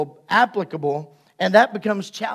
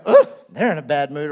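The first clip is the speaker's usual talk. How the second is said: mock judgment; 6 semitones lower, faster than usual. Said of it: "you look at them and go".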